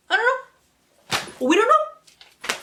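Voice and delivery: very high, slow, drawn-out sing-song; sing-song mystery. Acted. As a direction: stretch it high and teasing. Playful mock-mysterious shrug.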